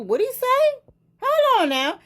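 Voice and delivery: high, loud startled question; startled double-take. Real, not acted. Catching a shocking word in the article, startled. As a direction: stop short, startled.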